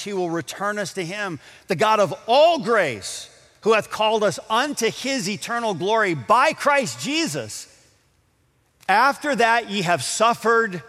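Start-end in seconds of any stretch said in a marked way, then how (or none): 1.7-10.8 raised, louder, ringing scripture declaration; preaching at full force. Acted. Pastor proclaiming the verse of glory to the congregation, fervent preaching. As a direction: proclaim the verse to the whole hall.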